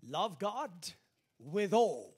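rising to a loud, emphatic preach on ALL. Acted. Pastor urging congregation, emphatic preach. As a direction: pastor driving the point home.

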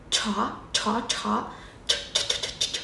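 "ch" đẩy hơi nhẹ, không thanh; "Chó" với "ch" bật hơi. Shown as a demonstration. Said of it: "kiểu bật hơi". ("ch" thì thầm bật hơi)

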